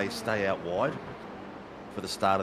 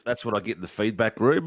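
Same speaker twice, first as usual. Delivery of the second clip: normal talking voice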